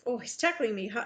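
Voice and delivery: ordinary delivery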